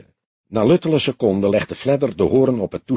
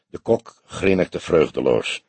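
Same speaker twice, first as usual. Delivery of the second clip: usual speaking voice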